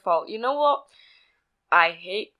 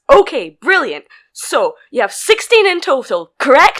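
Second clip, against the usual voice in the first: firm, patronising; 3 semitones higher, 7 dB louder than usual. Retold or imitated. Explaining slowly to customer.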